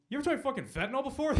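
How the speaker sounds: offered high and loud like a pushy dealer; paramedic's cheeky pitch to a thirsty patient, high and loud; mock sales pitch. Paramedic jokingly offers fentanyl.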